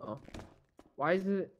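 usual speaking voice

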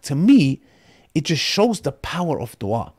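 normal talking voice

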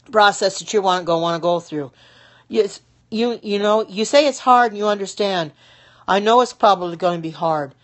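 ordinary delivery

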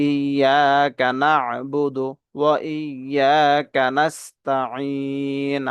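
slow ইয়াকা না'বুদু ওয়া ইয়াকা নাস্তাইন, last "ee" held long; measured Quran recitation. Shown as a demonstration. Teacher reciting the whole verse for learners, নাস্তাইন drawn out at the end. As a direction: recite very slowly, stretching the end of নাস্তাইন three beats. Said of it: "তিন আলিফ টেনে পড়ব মধ্যে আরজি".

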